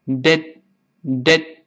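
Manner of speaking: "did" short and separate, final stop unreleased; two isolated stopped "did" syllables; voiced t, held ending. Shown as a demonstration. Teacher isolates the linked syllable, "did" with held ending. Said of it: "前面的t发的这个声音".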